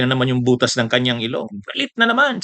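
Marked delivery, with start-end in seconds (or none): 1.6-2.4 high mocking quote of an angry man; mock angry Respicio. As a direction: mimic him raging, pitched up. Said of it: "sabi ni Respicio". (galit na panggagaya, mataas ang boses)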